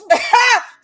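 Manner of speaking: high, loud shocked laugh; loud burst of laughter. Real, not acted.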